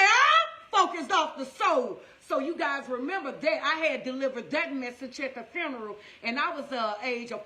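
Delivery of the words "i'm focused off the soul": loud, slow preached line; preaching. Retold or imitated. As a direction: deliver it like a sermon climax. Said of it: "He say".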